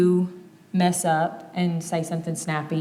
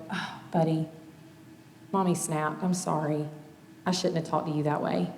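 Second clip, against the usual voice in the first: put-on softer, gentle apology to a child; tender apology; 6 dB quieter than usual. Mom apologizing to her son after snapping. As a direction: kneel down and make it right. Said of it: "Or I can pause and go".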